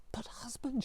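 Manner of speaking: whispered, pleading wife; urgent whisper. A narrator's reading. Tsarina begging her husband not to go, whispered. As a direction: breathe it out in alarm.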